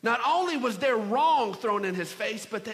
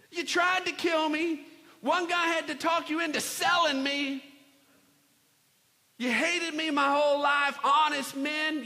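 high, raised-voice grievance; indignant accusation; 9 semitones higher, 5 dB louder than usual. Acted. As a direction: confront betrayers in an acted outburst. Joseph confronts brothers over betrayal.

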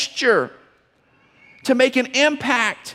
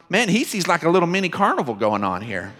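ordinary talk, nothing marked

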